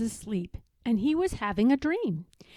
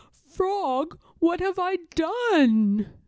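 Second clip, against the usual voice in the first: slow, higher anguished cry; cried out; 6 semitones higher, 4 dB louder, slower than usual. Acted. Toad realizes Frog has vanished, anguished cry. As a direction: cry out in dismay. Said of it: "cried Toad".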